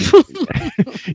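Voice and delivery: a sudden high laugh bursting out; high burst of laughter. Real, not acted. Laughing at his own line about life.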